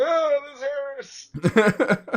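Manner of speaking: high, loud whiny cry of pain; mock pained whine. Retelling the pepper spray, high pained whine. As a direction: whine it like your lip is burning. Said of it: "so I was like".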